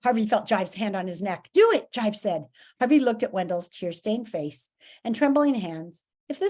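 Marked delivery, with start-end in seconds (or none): 1.5-1.9 higher, loud order; sharp, loud urging; urgent command. Acted. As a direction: goad the monster to attack. Villain urges monster to attack.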